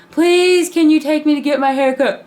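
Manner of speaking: put-on whiny pleading kid. Begging again, louder.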